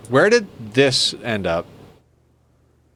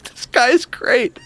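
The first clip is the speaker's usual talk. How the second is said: delighted; 12 semitones higher, slower than usual. Retold or imitated. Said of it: "I'm like".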